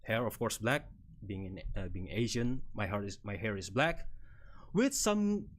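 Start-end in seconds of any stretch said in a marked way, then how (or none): none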